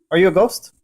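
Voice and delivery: ordinary delivery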